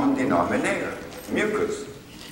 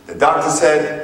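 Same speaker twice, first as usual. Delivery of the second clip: ordinary talk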